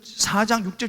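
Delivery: ordinary delivery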